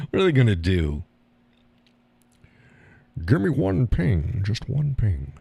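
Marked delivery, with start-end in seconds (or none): 3.3-5.3 slow, high-pitched mock Scottish accent as a sub captain; hammed-up Scottish accent quoting the film; Sean Connery impression. Quoting the sub captain to viewers, light Scottish accent. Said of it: "Hunt for Red October".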